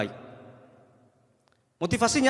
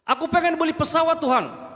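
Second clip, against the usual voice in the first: mock childish wish; 10 semitones higher, 3 dB louder than usual. Mocking an unrealistic prayer.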